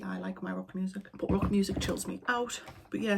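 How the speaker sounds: normal talking voice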